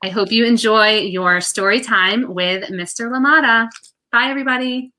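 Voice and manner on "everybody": ordinary delivery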